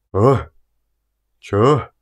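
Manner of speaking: groggy half-awake grunts; man roused from sleep, grumbling; sleepy mumble. A narrator's reading. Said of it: "мычит с просонья".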